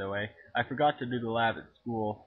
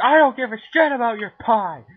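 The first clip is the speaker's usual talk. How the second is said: high, loud mock yell; angry sister yelling; 12 semitones higher, 14 dB louder than usual. Teen imitates the sister yelling about the pie. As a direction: scream it as the fed-up sister. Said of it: "And she'll be all like".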